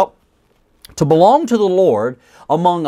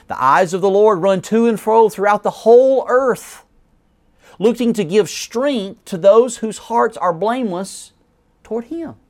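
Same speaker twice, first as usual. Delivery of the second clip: raised, measured reading of scripture; 5 semitones higher than usual. Acted. Preacher reading the verse to his congregation, raised and measured.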